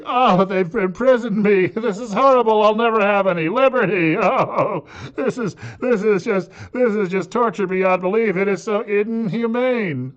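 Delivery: high, loud, slow wailing of a self-pitying caged chicken; hammed-up melodramatic chicken crying out about its cage; mock anguished chicken. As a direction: ham up the chicken's tragic lament, half laughing. Mocking imagined suffering chicken.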